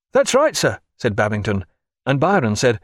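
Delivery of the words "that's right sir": high, bright-voiced young officer; eager midshipman. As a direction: chime in eagerly. Midshipman Babington agreeing.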